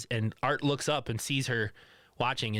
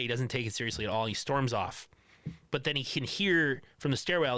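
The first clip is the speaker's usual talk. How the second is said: normal talking voice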